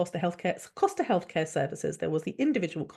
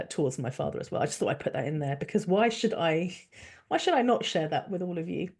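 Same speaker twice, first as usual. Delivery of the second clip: ordinary talk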